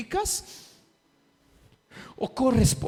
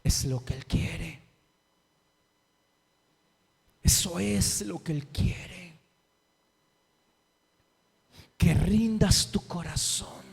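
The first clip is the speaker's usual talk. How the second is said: soft altar call; 3 semitones lower, 4 dB quieter than usual. Acted. Quiet intimate altar call.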